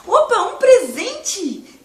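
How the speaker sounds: high, slow, laughing opa; delighted surprise at a gift; surprised prince. Acted.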